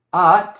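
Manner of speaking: "At" pronounced as a single clipped syllable, ending sharply on the t; primera sílaba aislada. Shown as a demonstration. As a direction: say "At" alone, cutting it off at the t. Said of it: "se vería así". ("At" como sílaba suelta, cortada en la t)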